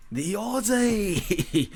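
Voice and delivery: put-on playful Australian accent; mock Australian accent. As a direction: stretch out "Aussie" like a mock Aussie bloke. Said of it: "The Aussie's gonna do a shoey".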